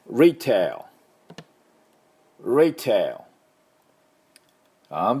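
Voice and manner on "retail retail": retail with English r and a final l. Shown as a demonstration. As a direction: say retail with r and a dark l ending. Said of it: "私の後でどうぞ".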